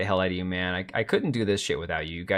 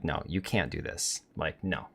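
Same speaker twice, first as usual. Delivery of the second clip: soft, low no you can't; quiet parental refusal, lower and softer; hushed firm denial to the daughter; 6 semitones lower, 9 dB quieter than usual. Retold or imitated. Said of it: "I'm like".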